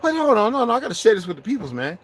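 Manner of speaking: very high, excited hammed-up ranting; squealing mock excitement; high hype voice. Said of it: "I said".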